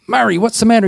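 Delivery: high calling out to a friend behind. Retold or imitated. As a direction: call back over your shoulder, worried.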